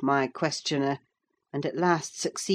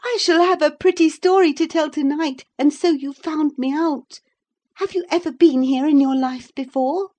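put-on much higher, louder girlish chatter; 11 semitones higher, 7 dB louder than usual. A narrator's reading. Cathy teasing Ellen for finding her, girlish glee. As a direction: chatter brightly as a delighted girl. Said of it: "she cried gaily".

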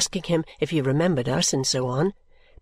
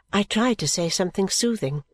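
ordinary delivery

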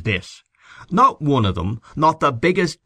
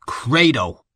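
ringing declaration, a touch raised; mild lion-like shout; 4 semitones higher than usual. A narrator's reading. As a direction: proclaim it like a restrained roar. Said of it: "shouted out with the voice of a lion".

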